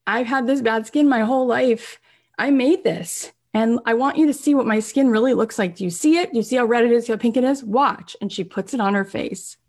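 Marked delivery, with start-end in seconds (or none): 0.0-8.2 open-hearted, higher-pitched earnest pitch; Jamie. Retold or imitated. Jamie shows her skin to the camera, earnest and open. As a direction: eagerly urge the viewers to look closely. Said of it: "she opens her heart and she's like".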